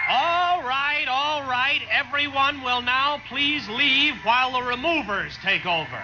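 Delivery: commanding shout. Acted. Schnee ordering the crowd out before the removal, commanding shout. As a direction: shout everyone out.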